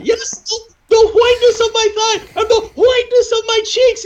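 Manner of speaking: very high, laughing comic voice; Muhammad voice. Retold or imitated. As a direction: squeak it out through laughter. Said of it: "So Muhammad would go".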